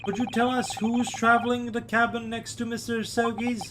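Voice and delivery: usual speaking voice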